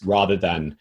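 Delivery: ordinary delivery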